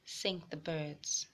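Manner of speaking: put-on gentle read-aloud voice for kids; storybook reading for young listeners; story narration. A narrator's reading. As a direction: tell it like a picture book to children. Reading a picture book aloud.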